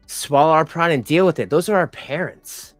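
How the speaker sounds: usual speaking voice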